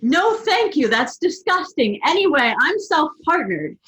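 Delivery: retorted. A narrator's reading. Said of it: "she retorted".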